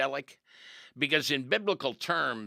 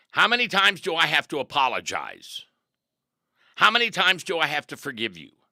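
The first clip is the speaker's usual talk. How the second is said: louder, higher, fed-up asking; exasperated question; 6 semitones higher, 8 dB louder than usual. Acted. A preacher voicing a fed-up forgiver, loud and exasperated. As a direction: throw up your hands in frustration. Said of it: "preacher asks in exasperation".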